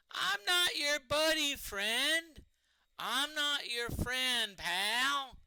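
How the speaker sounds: high, loud mock-indignant comedy voice, slowing on the second line; cartoonish high outrage; South Park quote. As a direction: bristle like the cartoon bit, dragging it out. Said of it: "It reminds me of the South Park".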